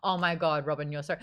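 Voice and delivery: ordinary talk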